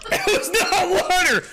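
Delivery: high, strained choking protest; choking outrage. Real, not acted. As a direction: splutter it out after a bad swallow. Coughing outrage after drinking not-water.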